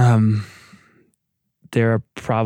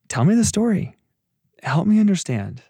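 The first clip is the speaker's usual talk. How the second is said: gentle, much higher, inviting voice; caring and kind; 9 semitones higher than usual. Pretend. God gently inviting a hurting person, caring and kind. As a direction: coax it out like asking your daughter. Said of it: "caring and kind".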